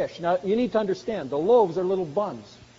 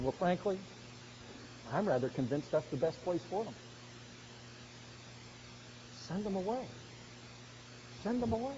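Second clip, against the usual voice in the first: much softer, coldly matter-of-fact; cold indifferent remark; 14 dB quieter than usual. Retold or imitated. Lebanese man telling Greg Livingston the lost deserve hell, cold and soft. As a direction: say it as if it costs you nothing. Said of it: "he looked at him and said".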